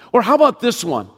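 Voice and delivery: normal talking voice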